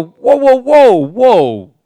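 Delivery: loud protest. Acted. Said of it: "And you go".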